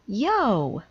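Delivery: loud sudden yelp; a startled high yelp; cry out. Acted. Amelia sitting on horns, loud surprised yelp. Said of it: "she cried".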